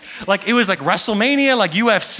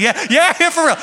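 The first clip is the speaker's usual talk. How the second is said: higher-pitched mock yell of encouragement; imitated yell; 9 semitones higher, 4 dB louder than usual. Preacher imitates his pastor yelling along, mock yell. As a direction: mimic a pastor calling out in support. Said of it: "I love Pastor yells that he".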